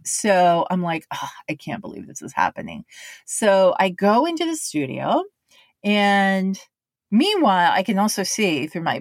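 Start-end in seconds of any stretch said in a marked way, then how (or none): 1.1-2.8 low, quick, quiet groan of disbelief; deflated low mumble; low mumbled despair. Retold or imitated. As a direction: mutter it like you want to vanish. Forced to record bad line.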